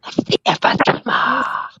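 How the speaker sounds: slow, breathless whisper. Pretend. Joking whisper attempt.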